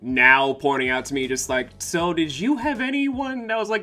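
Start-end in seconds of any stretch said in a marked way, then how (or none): 1.8-3.8 higher-pitched, probing question; imitating concerned questioner. People asking about a guardian, imitated high and questioning. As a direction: ask it in a raised, incredulous mock voice.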